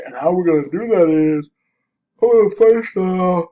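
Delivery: talking through a yawn; words stretched by a yawn. Real, not acted. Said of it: "I'm yawning".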